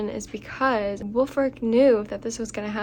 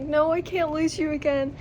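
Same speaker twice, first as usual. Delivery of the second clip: higher, pained pleading cry; anguished cry; 6 semitones higher than usual. Retold or imitated. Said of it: "Jax starts, like, screaming".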